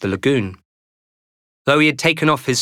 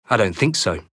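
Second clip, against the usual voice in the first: solemnly; 3 semitones lower than usual. Acted. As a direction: say it gravely, like big news. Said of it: "said this solemnly".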